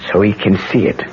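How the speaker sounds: ordinary talk, nothing marked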